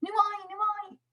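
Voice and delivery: put-on high call of a boy running up. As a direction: call his friend's name from afar.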